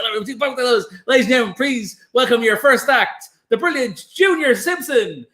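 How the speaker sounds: lightly voiced compere announcing an act; compere introduction.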